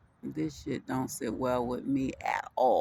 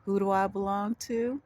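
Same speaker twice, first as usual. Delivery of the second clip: higher, rising call for an answer; rousing prompt; 5 semitones higher than usual. Shown as a demonstration. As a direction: lift the question up, inviting a confident reply. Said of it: "say it like you mean it".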